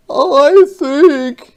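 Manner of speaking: high, loud, firm giant; firm creature voice. Acted. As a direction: state it firmly in the monster's high voice. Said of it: "he said firmly".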